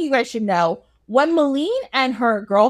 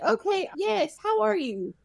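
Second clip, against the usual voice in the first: high, soft, strained-polite greeting; small, pitched-up mock courtesy; awkward politeness; 9 semitones higher, 6 dB quieter than usual. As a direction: greet someone while hiding your shock. Shocked prince trying not to be rude.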